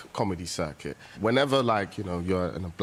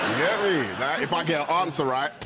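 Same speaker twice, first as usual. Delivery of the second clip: very high, loud hype shouts; hyping the crowd like a comedy-circuit DJ; hype man; 5 dB louder than usual. Acted.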